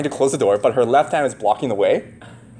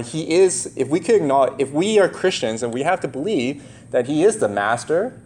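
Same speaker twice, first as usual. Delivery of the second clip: ordinary delivery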